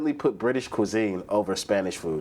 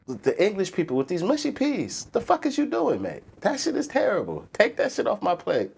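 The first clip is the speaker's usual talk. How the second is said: pitched-up disgusted rant; high mock rant; 10 semitones higher than usual. To chat, ranting about English mushy peas in a pitched-up voice. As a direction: squeal your outrage at the mushy peas.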